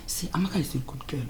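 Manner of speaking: soft whispered praise; persuasive whisper. Acted. Mother whispers to her son about the girl. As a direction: whisper it to win him over.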